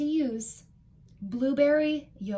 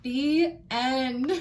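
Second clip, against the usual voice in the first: usual speaking voice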